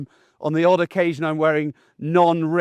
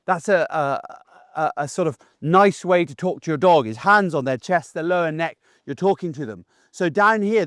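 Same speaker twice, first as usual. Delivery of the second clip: usual speaking voice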